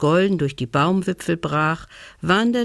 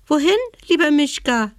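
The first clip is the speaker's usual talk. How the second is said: much higher, fast, squeaky little mouse voice; piping dormouse; 9 semitones higher, faster than usual. Acted. Dormouse to the bear, a high piping question. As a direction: peep it like a tiny dormouse. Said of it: "piepste die Haselmaus".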